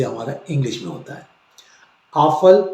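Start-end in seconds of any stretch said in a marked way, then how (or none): 2.1-2.6 आफल said louder and higher; आफल with a German pf; German Apfel. Shown as a demonstration. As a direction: stress आफल clearly. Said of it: "आपका singular है और एफ़एल पढ़ते हैं plural".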